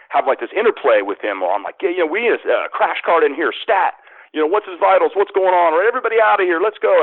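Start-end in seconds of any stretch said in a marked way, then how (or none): 1.8-7.0 higher-pitched emergency doctor giving orders; urgent doctor. Acted. Doctor rallying the crash team for his scene, urgent and high. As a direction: fire off urgent medical orders, pitch raised.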